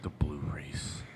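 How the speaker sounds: a soft whispered echo of the name. Acted. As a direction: breathe the name out as a secret. Echoes the proposed name.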